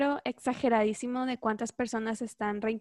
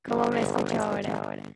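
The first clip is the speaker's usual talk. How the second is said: glitchy robotic connection voice; distorted like a broken video call. Real, not acted. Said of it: "Como robot".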